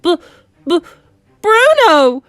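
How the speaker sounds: stuttering, then a high loud burst; shocked stammer. Acted. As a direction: stick on the B, then blurt the name. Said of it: "stammered Mister Jenkins".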